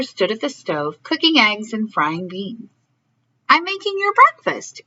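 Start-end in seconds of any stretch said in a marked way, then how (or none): 3.5-4.8 lightly voiced higher mother; a hint of the mother. A narrator's reading. Mother answers her son at the stove, lightly voiced. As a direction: answer kindly as mom. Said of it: "answered his mother".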